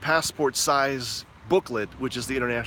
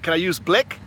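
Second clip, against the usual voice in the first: smart-aleck ask; 3 semitones higher, 4 dB louder than usual. Retold or imitated. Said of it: "the smart ass thing that I said".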